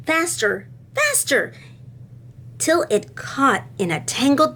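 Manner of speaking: put-on expressive read-aloud to children; lively classroom storytime voice; storybook reading. A narrator's reading. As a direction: tell it like a picture book to kids. Reads a picture book aloud to class.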